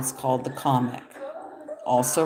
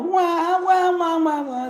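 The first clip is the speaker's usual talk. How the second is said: mock cat crying; 13 semitones higher than usual. Imitating the sad cat reel, high mock crying. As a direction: sob like a cartoon cat, pitched up. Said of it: "the cat's all".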